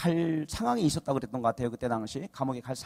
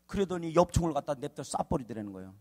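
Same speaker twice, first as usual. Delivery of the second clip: ordinary talk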